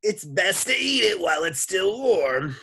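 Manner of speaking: high, loud cartoon lady voice; sloshy lunch lady. A narrator's reading. Lunch lady calls through the door, high sloshy holler. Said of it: "like two boots sloshing through the mud".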